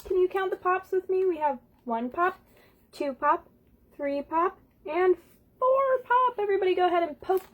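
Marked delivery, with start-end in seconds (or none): none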